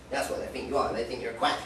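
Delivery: ordinary talk, nothing marked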